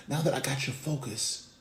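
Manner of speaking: ordinary delivery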